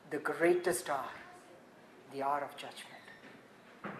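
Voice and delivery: hushed solemn sermon line; hushed awe. Acted. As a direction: drop to a near whisper on the judgment. Hushed dramatic close.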